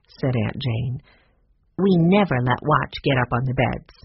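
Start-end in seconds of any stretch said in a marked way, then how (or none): none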